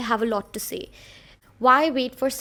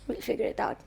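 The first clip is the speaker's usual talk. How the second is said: soft unvoiced whisper of self-encouragement; whispered pep talk; 7 dB quieter than usual. Real, not acted. Coaching herself through the intro, whispered. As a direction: hush it to yourself like a secret pep talk.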